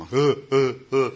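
loud, heaving mock sobs; imitated sobbing. Retelling his breakdown on the phone, imitated loud sobs. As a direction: act out bawling in short bursts. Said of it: "by then I'm just crying".

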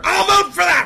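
high, loud gleeful yell; mock eager shout. Comic seconding his own motion, loud gleeful shout.

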